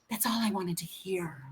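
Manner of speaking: soft unvoiced whisper through tears; tearful whisper. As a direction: let it barely escape, moved. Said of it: "she starts crying".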